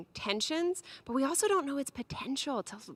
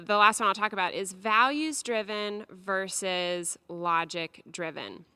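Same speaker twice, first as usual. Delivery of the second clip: usual speaking voice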